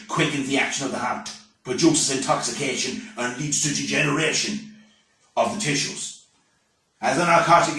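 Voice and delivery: solemn warning, key words punched; declamatory schoolbook recitation. Acted. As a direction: deliver a temperance lecture with mock-grave emphasis. Recites school reader's temperance lesson.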